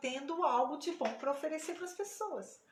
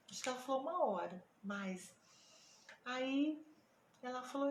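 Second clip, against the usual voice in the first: ordinary delivery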